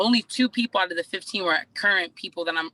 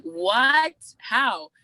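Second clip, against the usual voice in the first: mock disbelief, pitched up on "What?"; incredulous; 5 semitones higher, 3 dB louder than usual. Coach hears she didn't love it, incredulous. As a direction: react as if you can't believe it.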